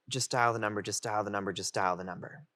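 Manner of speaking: acted anxious self-talk, trailing down; retold inner urging. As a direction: chant it under your breath to yourself. Anxious inner thought, urging himself.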